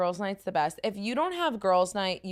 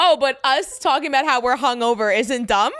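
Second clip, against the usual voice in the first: loud, raised-pitch challenge; defensive; 5 semitones higher, 9 dB louder than usual. Real, not acted. Host challenges guest who called it dumb, defensive. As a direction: throw it back at your critic. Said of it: "Why are you being defensive?".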